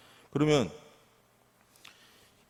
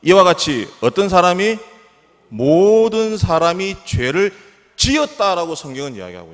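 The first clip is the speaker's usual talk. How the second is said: loud, high, emphatic preaching; forceful preaching; 7 semitones higher, 8 dB louder than usual. Real, not acted. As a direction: drive the doctrine home with stretched key words. Preaches the point at full force.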